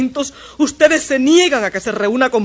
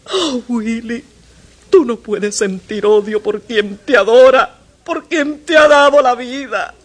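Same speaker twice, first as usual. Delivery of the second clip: sobbing mother. Acted. Mother crying to her son who says he doesn't love her. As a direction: wail out the pain of rejection. Mother cries over son's rejection.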